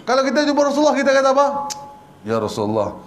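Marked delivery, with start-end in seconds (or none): 2.2-3.0 low, deferential call to a leader; meek address. Acted. Preacher acts a follower greeting the Prophet, meek and low. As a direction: address him humbly, voice dropped low.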